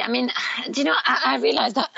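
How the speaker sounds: hoarse, failing voice; losing voice. Real, not acted. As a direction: voice giving out mid-sentence. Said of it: "sorry, I'm losing my voice".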